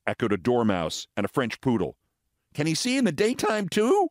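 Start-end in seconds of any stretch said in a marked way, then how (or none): 2.5-4.1 high, rising mocking repeat; mocking echo. Creatures echoing the fox's question, high and mocking. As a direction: parrot the question back in a high voice.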